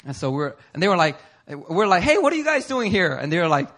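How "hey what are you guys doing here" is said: high-pitched casual hello; acted friendly greeting. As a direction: act pleasantly surprised to meet them. Acted casual greeting, turned back next.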